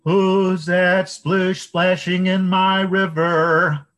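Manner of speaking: high, loud, slow river fish; big fish voice. A narrator's reading. River fish challenges the troll in the water, high, loud and slow.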